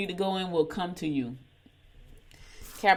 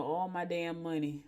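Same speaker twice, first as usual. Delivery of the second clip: mock groaning husband; 9 dB quieter than usual. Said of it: "listen to this right now and go".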